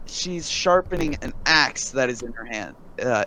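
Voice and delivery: normal talking voice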